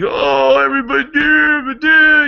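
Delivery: high, loud whining mock shout; hammed-up high whine at everybody; whiny complainer. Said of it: "weak, whiny, pissy, moaning, complaining".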